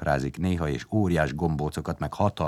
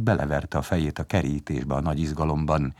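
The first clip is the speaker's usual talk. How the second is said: plain reading voice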